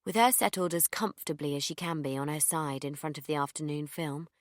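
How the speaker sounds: ordinary delivery, nothing marked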